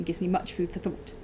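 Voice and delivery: plain reading voice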